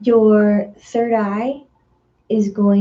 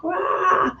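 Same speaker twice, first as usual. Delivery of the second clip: mock scream; 11 semitones higher, 4 dB quieter than usual. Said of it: "The solar plexus can scream".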